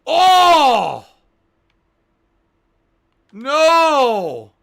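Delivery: very loud high wail of dismay; anguished yell. Real, not acted. Streamer finds his bed gone, anguished yell. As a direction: howl at the loss.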